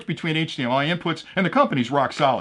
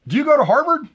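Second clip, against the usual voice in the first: high, eager question; plain first asking; asking politely; 11 semitones higher, 4 dB louder than usual. Acted. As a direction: lean in and ask brightly. Joke, man asks woman in bar.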